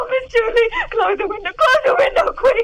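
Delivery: a terrified maid begging for the window shut; panicked. Acted. Maid to her mistress after the murder, panicking.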